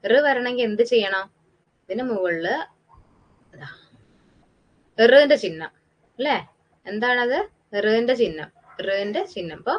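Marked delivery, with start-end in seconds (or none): none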